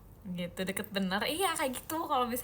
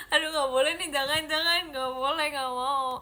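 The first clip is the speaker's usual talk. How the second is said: giggling, half-pleading no; tickled giggly no-no; laughing protest; 3 semitones higher, 5 dB louder than usual. Real, not acted. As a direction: laugh through the refusal. Laughing at own prank idea.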